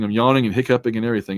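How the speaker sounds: ordinary talk, nothing marked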